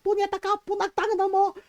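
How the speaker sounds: angry swearing. Retold or imitated. Mom swearing at the would-be thief, angry. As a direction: imitate an irate mom cursing. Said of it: "she's swearing at him in Tagalog and in English".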